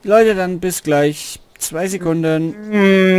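loud, slow call as he walks off; yelling across the room. Real, not acted. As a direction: call it out from away. Leaving the stream briefly.

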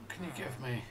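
soft unvoiced whisper. Real, not acted. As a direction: whisper it to the trapped cleric. Sneaking up on trapped cleric.

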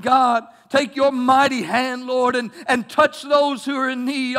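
loud, slow pleading prayer; a deacon's booming prayer; fervent prayer. Acted. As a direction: pray it loud and passionate.

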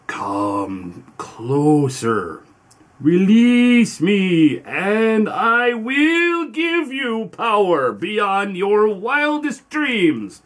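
slow, rasping ancient spirit; raspy ancient voice. Acted. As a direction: drag each word out like something old and buried. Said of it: "a raspy voice, more ancient than time itself".

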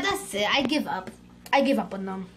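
ordinary talk, nothing marked

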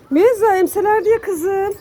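sevgiyle, yüksek sesle kediye seslen; kediye tatlı ses. Real, not acted. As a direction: anne şefkatiyle kedi sevme. (kediye seslenen sahibi, şefkatli ve tiz)

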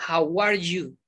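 "How are you?" joined as one unit; linked together. Shown as a demonstration. Teacher showing learners linkers, words joined in one. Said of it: "La uno toda".

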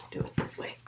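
quick whispered instruction off to the side; whispered aside. Real, not acted. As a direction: whisper it so the mic barely hears. Whispered aside during reading.

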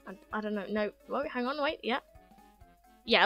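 ordinary talk, nothing marked

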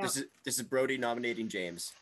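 ordinary delivery

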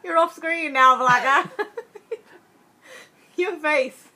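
high, loud, laughing words; giggling while pushing back; laughing through strain. Real, not acted. Wife laughs at husband while pressing thumbs, high and loud.

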